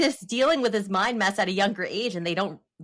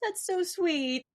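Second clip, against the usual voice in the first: touched cooing; 9 semitones higher, slower than usual. Retold or imitated. Mom retelling her reply to her daughter's comfort, touched cooing.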